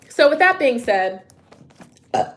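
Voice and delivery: usual speaking voice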